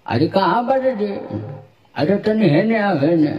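put-on high shrill-free old lady calling out; cackling granny calling her friend; old woman voice. Shown as a demonstration. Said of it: "ये बूढ़ी का आवाज था". (बूढ़ी औरत की आवाज़)

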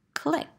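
a small high soft click; tiny clicking sound; mock click. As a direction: make the lock's little click noise. Sound effect in story.

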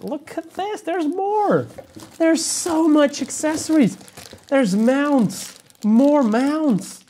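high-pitched, hammed-up excitement over a haul; mock amazement. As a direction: gush in escalating, over-the-top wonder. Mock amazement at endless accessories.

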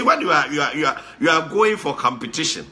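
ordinary talk, nothing marked

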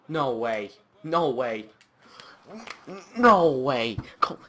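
loud, building yell; last no way bellowed; disbelieving shout. Real, not acted. Disbelief at a miss.

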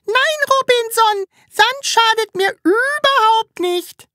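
very high, slow squeaky voice for the stomach; tiny high voice. A narrator's reading. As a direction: answer as a little squeaky stomach. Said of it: "antwortete mit hohem Stimmchen".

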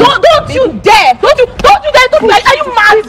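angry warning. Real, not acted. Woman warns the car washer not to touch her, angry. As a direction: stop him cold with each word.

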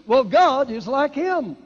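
slow, loud, God stressed high; preaching emphasis; emphatic. Real, not acted. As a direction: drive the word God home. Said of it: "I emphasize that".

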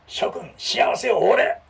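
hammed-up lordly proclamation; grand hero. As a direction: address the crowd like a self-styled saviour. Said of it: "かっこええやんか".

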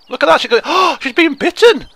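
high, loud, gasping exclamation; loud high-pitched astonishment; amused shock. Real, not acted. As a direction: cry out in gleeful horror. Watches character being bitten.

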